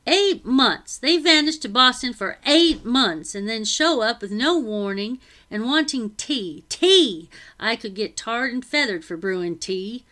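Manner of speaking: loud, exasperated servant ranting; indignant rant. Acted. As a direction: fume about the masters, loudly. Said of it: "she muttered".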